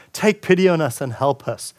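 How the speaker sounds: ordinary talk, nothing marked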